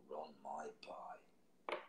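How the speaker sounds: slow breathy whisper. Acted. Poet closing the poem to listeners, whispered slowly. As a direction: let it fall to a slow whisper.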